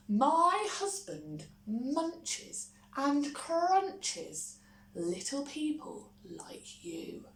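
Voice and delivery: slow, spooky warning fading to a whisper; warning giantess. A narrator's reading. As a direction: menace him gently. Giantess warns Jack.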